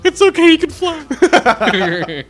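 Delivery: high, slow, hammed-up cooing; mock-tender cartoon voice, high and loud; mock comforting. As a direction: coo like a cartoon mother. Mock Dumbo scene, comforting voice.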